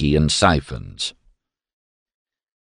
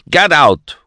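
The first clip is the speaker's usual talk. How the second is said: "Get out" barked loud; shouted order; 9 semitones higher, 5 dB louder than usual. A narrator's reading. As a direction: throw them out firmly.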